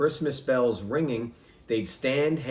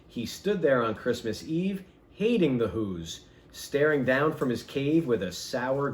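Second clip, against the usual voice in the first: usual speaking voice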